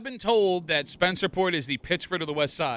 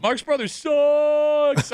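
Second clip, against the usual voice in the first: mocking taunt; 9 semitones higher, 3 dB louder, much slower than usual. Host mocking caller's brother.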